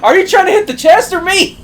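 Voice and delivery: very high, loud protest; incredulous shout. Real, not acted. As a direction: yell at a friend who is hitting you. Friend's attack hits him in game.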